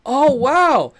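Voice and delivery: high, loud "wow" of wonder; mock amazement. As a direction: act out a big impressed reaction. Acted amazed reaction in retold chat.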